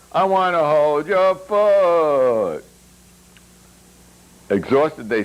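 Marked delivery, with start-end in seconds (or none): 0.1-2.6 high, loud silly shout. A narrator's reading. Yuri yelling the lyric on the dance floor, high and loud. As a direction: belt the twisted lyric over the music. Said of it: "Yori shouted".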